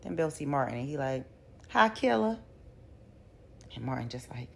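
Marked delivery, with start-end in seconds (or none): 1.7-2.4 higher and louder, a teasing jab; smug taunting hello; sly mocking greeting. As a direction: toss it out like a smug insider. Bill greets Martin mockingly.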